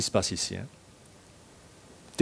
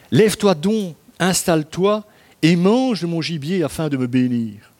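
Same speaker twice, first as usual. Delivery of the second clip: measured, solemn Bible reading; steady liturgical reading voice; scripture reading; 4 semitones higher than usual. Acted. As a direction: read the passage like a lector at the pulpit. Continues reading Genesis aloud.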